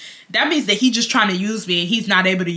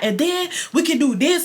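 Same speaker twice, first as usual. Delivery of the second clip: excited quote with lifted pitch; retold excitement; 7 semitones higher than usual. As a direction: imitate him getting carried away. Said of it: "he starts getting very excited".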